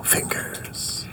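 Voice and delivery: unvoiced whisper for snaps. Real, not acted. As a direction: whisper the cue. Host cueing finger snaps.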